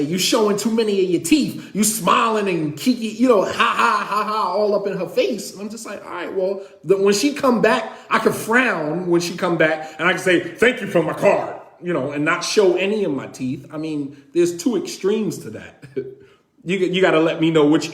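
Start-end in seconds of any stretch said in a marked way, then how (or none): 0.0-5.5 mock wife scolding, with hammed-up ha ha laughs; nagging wife. Wife scolding husband for smiling, mocking laugh. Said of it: "as my wife say". 6.0-13.7 sarcastic reply. Retold or imitated. Husband's sarcastic reply to wife.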